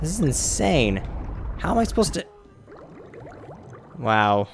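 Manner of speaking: can't believe how hard this is; frustrated disbelief. Real, not acted.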